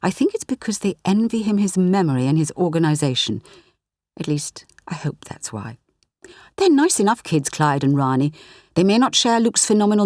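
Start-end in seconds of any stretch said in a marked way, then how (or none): 4.2-5.8 soft unvoiced worried aside; whispered aside. A narrator's reading. Narrator confiding her worry about Luke, whispered. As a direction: breathe it as a private doubt.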